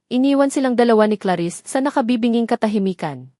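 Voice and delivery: ordinary delivery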